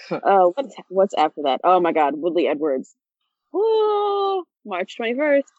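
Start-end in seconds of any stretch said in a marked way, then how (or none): 3.5-4.4 high excited woo; cheer. Real, not acted. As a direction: cheer like the card just dropped. Cheering a fight announcement.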